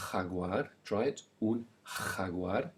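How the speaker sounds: jaguar with a raspy kh, khaguar, slowly; teacher models the Spanish j for the class; breathy ch sound. Shown as a demonstration. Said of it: "expel lots of air as you make the ch sound".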